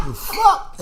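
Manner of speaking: high, startled mock reaction; mock shock. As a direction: play your own startled double take. Acting his own shocked reaction.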